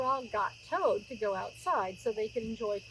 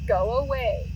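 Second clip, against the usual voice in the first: grumpy Toad; 8 dB louder than usual. A narrator's reading. Grumpy Toad hiding in bed.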